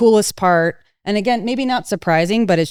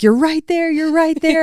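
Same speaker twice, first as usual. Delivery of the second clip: much higher, eager coaching voice; imitating urgent encouragement; 10 semitones higher than usual. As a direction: urge a learner who is almost there. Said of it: "I'm like".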